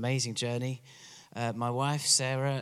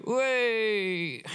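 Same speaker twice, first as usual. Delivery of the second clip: a higher, rousing "Way!" the English way; English crowd cheer; 10 semitones higher than usual. Retold or imitated. Preacher showing how the English cheer, higher rousing call. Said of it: "we go".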